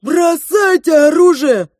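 loud, high, drawn-out command; forceful shouted order; soldiers' shout. A narrator's reading. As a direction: yell it out like a charging soldier. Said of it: "закричали подбежавшие к нам солдаты".

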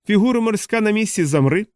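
вище, дзвінкий вигук хору голосів; вигукни високо, ніби грайлива команда. A narrator's reading. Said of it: "вигукнув хор голосів із його вуст". (вигук високим голосом)

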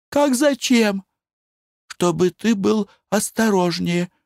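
a nervous wife begging; anxious wife. Acted. Wife's voice.

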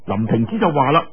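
plain reading voice

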